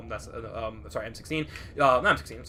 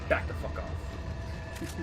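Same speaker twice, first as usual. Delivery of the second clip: slow lower whispered threat; hushed threat; 9 semitones lower, 11 dB quieter, much slower than usual. Real, not acted. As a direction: hiss the warning slowly at the enemy. Threatening in-game enemy.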